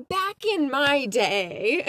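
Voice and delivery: slow mock old-timer drawl. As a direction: drag it out like a grumpy elder. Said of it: "I can't wait to be like".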